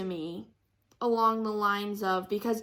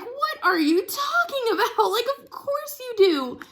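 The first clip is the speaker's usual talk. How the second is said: much higher, laughing, imitating his disbelief; 13 semitones higher, 5 dB louder than usual. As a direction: laugh it off as if the idea is absurd. Retelling boyfriend's incredulous reassurance.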